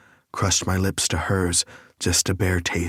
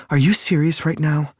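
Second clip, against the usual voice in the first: higher, louder female voice, challenging him; Lake's voice; 9 semitones higher, 6 dB louder than usual. A narrator's reading. Lake whirling on Nick, demanding a higher louder answer. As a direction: round on him as Lake, sharp and disbelieving. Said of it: "Lake asked as she whirled on me".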